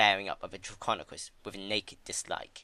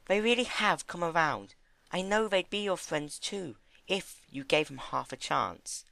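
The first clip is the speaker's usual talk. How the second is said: gentle Fluttershy; 8 semitones higher than usual. Acted.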